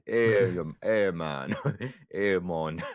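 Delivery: hammed-up Jamaican greeting, half laughing; attempted Jamaican accent. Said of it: "it turned out to be Jamaican".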